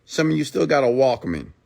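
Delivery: normal talking voice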